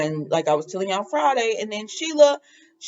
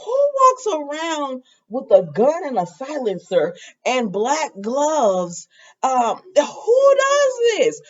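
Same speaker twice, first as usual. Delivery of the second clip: exasperated outcry; 5 semitones higher, slower than usual. Real, not acted. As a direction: cry out in disbelief at the villain. Exasperated at the soap villain.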